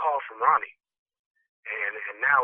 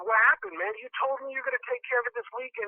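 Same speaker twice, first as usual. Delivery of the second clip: mildly frustrated, higher-pitched confrontation; 9 semitones higher, 3 dB quieter than usual. Retold or imitated. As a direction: press him for an explanation, mildly exasperated. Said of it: "I was a little frustrated".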